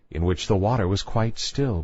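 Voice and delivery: usual speaking voice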